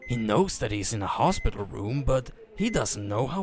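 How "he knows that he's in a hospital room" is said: usual speaking voice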